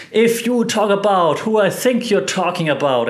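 high-pitched mock indignation; indignant retort. Acted angry retort of Kenny.

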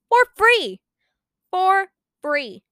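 high, loud triumphant cry; excited shout. Real, not acted. Teen boasting to viewers about free Kindle books, shouting with glee.

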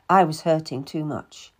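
plain reading voice

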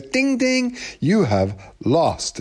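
high ding ding, then a very slow robotic verdict; mock computer voice, high then slow; machine announcer. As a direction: announce the loss like a spooky chess computer. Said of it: "And he goes".

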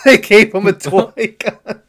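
high, loud, slow words through laughter; real laughter breaking up the words; laughing. Real, not acted. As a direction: crack up while saying it. Laughs at the film's reveal.

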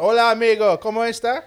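high, loud mock Spanish tourist; exaggerated Spanish accent; hammy Spanish accent. Shown as a demonstration. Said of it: "You're supposed to".

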